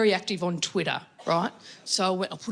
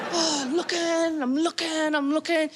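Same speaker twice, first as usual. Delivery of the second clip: frantic searching; 7 semitones higher, slower than usual. Retold or imitated.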